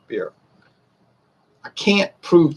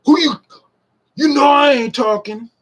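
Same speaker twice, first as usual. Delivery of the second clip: much higher, loud indignant yell of a student; mock student outburst; 13 semitones higher, 4 dB louder than usual. Retelling a high school student jumping up, mock indignant shout. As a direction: blurt it out like a kid caught out, mock offended.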